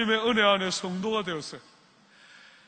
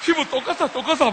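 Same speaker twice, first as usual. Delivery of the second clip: higher, louder, brushing it off; mock insistent relative; 6 semitones higher, 6 dB louder than usual. Korean relative insisting to the curious one, mock loud. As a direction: insist over them, hammed up.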